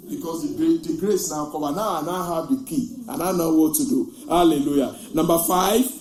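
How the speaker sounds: loud, driving sermon voice; full-force preaching. Real, not acted. Pastor rousing the congregation, loud preaching. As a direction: preach it out to the back row.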